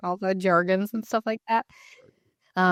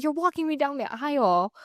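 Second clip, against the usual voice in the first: high-pitched, incredulous objection; exasperated protest; 9 semitones higher than usual. Retold or imitated. Bride reminding her dad of the moment, exasperated.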